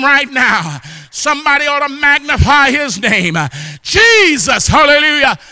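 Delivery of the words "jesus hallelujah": high, ringing cry of praise; exultant shout. Real, not acted. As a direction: shout the name out to the congregation. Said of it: "somebody ought to shout his name".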